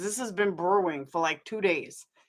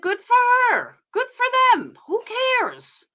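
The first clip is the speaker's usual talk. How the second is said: high sarcastic sing-song, much higher; mock dismissive; 14 semitones higher than usual. Real, not acted. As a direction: toss it off in a squeaky mocking voice.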